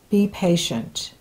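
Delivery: dropped low and soft, almost under her breath; teasing, reluctant. Real, not acted. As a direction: say the dreaded word quietly, as if it were forbidden. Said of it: "I said that dirty P word".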